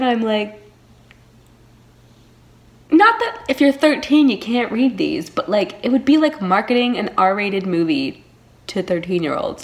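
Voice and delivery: normal talking voice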